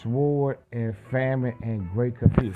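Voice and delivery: ordinary talk, nothing marked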